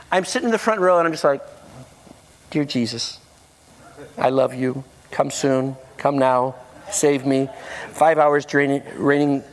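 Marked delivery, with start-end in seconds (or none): 2.5-7.5 low, monotone pleading to Jesus; mock-dutiful muttered prayer; deadpan retold prayer. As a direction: pray it in short, level phrases. Sullen silent prayer in front row.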